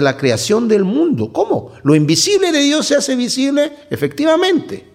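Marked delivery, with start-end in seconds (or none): 1.3-4.8 high, astonished rhetorical question; mock surprise. Preacher to congregation, mock astonished question. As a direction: feign amazement then confirm it.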